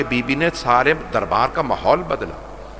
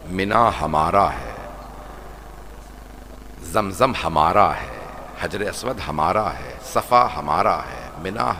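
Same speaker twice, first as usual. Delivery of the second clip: solemn recitation; 5 semitones lower than usual. Acted. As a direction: intone each line with weight. Imam's sermon, low and slow.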